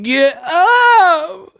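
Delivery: high, squealed falsetto yell; mocking yell. Showing how he would never yell at a fallen man, squealed yell. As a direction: shriek it as what a man should never do. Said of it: "I'm not finna be like".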